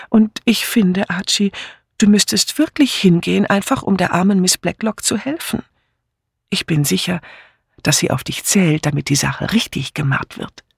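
high, sweet wheedling; coaxing young wife. Acted. Wife persuading her husband, high sweet coaxing. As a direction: coax him with a high sweet voice.

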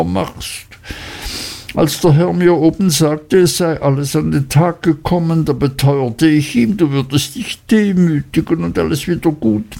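high, anxious mother's voice throughout; an anxious mother begging her son and the gentlemen; pleading mother. A narrator's reading. Mrs Heep begging the gentlemen to ignore her son, high and anxious.

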